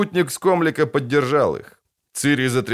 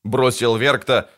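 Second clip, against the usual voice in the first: usual speaking voice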